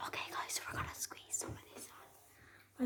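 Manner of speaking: hushed whisper so mom can't hear. Real, not acted. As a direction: whisper it secretly. Hiding the sauces from mom.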